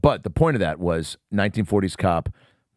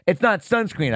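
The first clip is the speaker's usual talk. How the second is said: much higher-pitched imitated girl; teen girl; 10 semitones higher than usual. Girl corrects the cop in front of the group, imitated teen. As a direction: lightly voice a girl correcting an adult.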